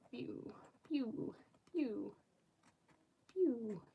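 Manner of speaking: quiet little pew sound effects; soft, playful imitated zaps; soft pew noises. Real, not acted. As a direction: idly voice tiny laser shots. Makes little noises while drawing.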